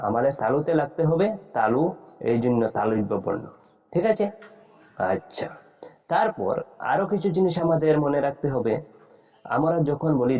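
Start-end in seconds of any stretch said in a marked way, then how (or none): none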